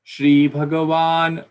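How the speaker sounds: Shri Bhagavan said slowly, both words clear; slow, weighty reading of Shri Bhagavan; careful recitation. Shown as a demonstration. Teacher recites the verse opening slowly. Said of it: "emphasize on this matra".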